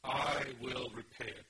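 loud, drawn-out "I", then slow "will repay it"; emphatic I. Acted. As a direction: lean long and hard on "I". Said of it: "this is unusual emphasis".

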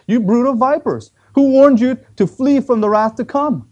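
loud, high prophetic denunciation; thundering rebuke. Retold or imitated. John confronts the Pharisees who came for baptism, fierce acted rebuke. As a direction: hurl it out like an accusing prophet.

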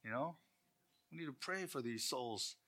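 a hint of a sorrowful praying parent, slowed; mildly mock-pious parent; pious sigh. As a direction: lament lightly to your spouse. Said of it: "And you go".

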